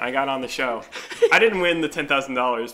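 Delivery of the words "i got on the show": ordinary talk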